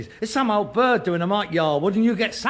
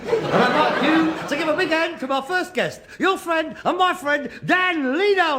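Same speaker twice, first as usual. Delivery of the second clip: high, rising variety-show announcer; mock Henry the Eighth; 9 semitones higher than usual. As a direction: build up the guest like a showbiz host. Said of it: "This is Henry the eighth speaking".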